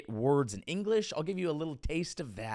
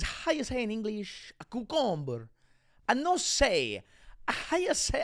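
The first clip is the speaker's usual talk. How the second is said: higher-pitched mock Spanish accent; fake Spanish accent; 6 semitones higher than usual.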